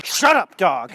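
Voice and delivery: high, loud snapped yell; yelling at dog. Retold or imitated. Yells at barking dog.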